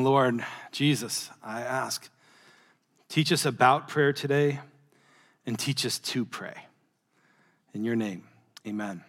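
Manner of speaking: low, slow, hushed prayer voice; quiet reverent prayer. Real, not acted. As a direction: pray it softly and slowly, head bowed. Pastor opens sermon in prayer.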